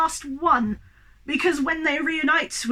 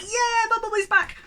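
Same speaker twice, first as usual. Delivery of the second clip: excited cheer; 10 semitones higher than usual. Retold or imitated. Re-enacting own cheer at film moment.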